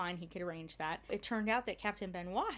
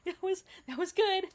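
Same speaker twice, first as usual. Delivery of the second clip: laughing; 11 semitones higher, 6 dB louder than usual. Real, not acted. Laughing about it.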